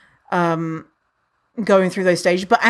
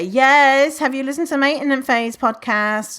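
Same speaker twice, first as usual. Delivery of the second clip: imitated enthusiasm; 6 semitones higher than usual. People recommending a rival podcast, imitated enthusiasm. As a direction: mimic someone eagerly plugging a favourite.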